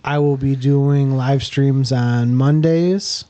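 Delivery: ordinary talk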